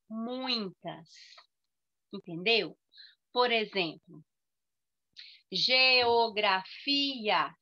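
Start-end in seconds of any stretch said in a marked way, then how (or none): 5.5-7.5 "geografia" slowly counted out in five syllables; every syllable of "geografia" given its own beat. Shown as a demonstration. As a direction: stretch "geografia" as ge-o-gra-fi-a. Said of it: "cinco sílabas".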